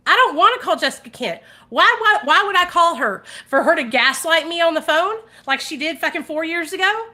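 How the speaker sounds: loud, high indignant rant; heated. Real, not acted. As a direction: rant at her, fed up. Angry rant about a rival.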